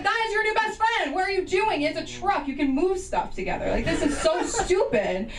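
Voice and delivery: high, exasperated, laughing by the end; incredulous scolding. Retold or imitated. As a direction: scold him in disbelief. Son scolding dad, incredulous.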